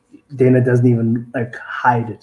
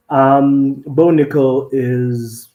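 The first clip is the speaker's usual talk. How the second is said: louder, drawn-out lead-in; loud emphatic; 3 semitones higher, 8 dB louder, much slower than usual. Real, not acted. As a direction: lean in hard and slow.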